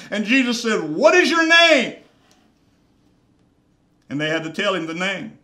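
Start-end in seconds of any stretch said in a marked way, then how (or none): 0.8-2.0 high, loud commanding question; loud demand. Acted. Jesus demanding the demon's name, high loud command. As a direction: bark it as a stern order.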